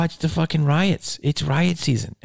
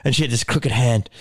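ordinary delivery

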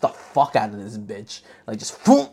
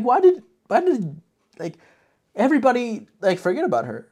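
incredulous outburst; 11 semitones higher, 9 dB louder than usual. Retold or imitated. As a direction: demand it in mock outrage. Narrator's own outraged reaction.

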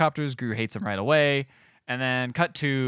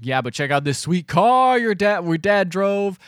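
imitating an excited brother, higher and louder; mock-excited brag; eager showing off; 6 semitones higher, 5 dB louder, slower than usual. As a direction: show off the car, drawn out and high. Eager brother showing off car, retold.

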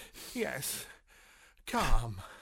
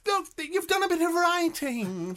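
panicked pleading; 9 semitones higher, 7 dB louder, slower than usual. Acted. Agent panics as Rob threatens.

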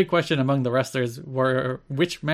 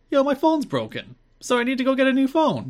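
much higher, mocking ditzy voice; mocking Goldilocks; 11 semitones higher than usual. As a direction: squeak it out like a clueless starlet. Retelling Goldilocks's line in video.

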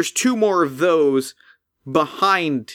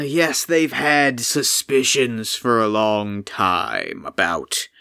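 weary golem; 2 semitones lower, slower than usual. Acted. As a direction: sigh it out like a weary giant. Golem voice held.

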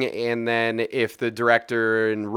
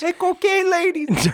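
interjected character voice. Acted. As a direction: call it out high like a heckler. Interjected movie-style catcall.

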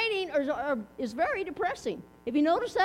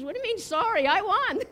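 higher, louder mock indignation tipping into triumph; triumphant retort; 4 semitones higher, 4 dB louder than usual. Wife to sympathetic neighbor, lightly acted triumphant retort. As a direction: hit the punchline high and gleeful.

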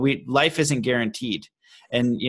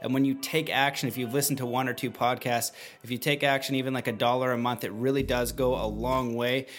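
softer, steady sign-off over music; podcast outro read; 6 dB quieter than usual. Acted. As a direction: wrap up the show gently over music.

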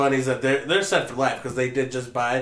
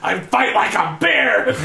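mock tough guy; 7 semitones higher, 5 dB louder, slower than usual. Mocking a wrestler's quote.

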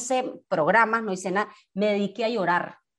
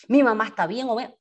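ordinary delivery

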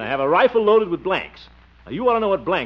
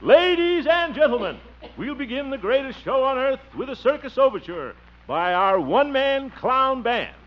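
circus announcer; 8 semitones higher than usual. Pretend. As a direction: boom it out like a ringmaster opening the show. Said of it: "I'll make the opening announcement".

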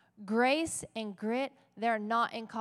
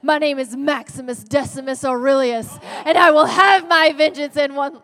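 building to a huge, loud declaration; roaring gladiator; 4 semitones higher, 13 dB louder than usual. Retold or imitated. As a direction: proclaim it like a vengeful hero, louder and louder. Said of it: "he's like".

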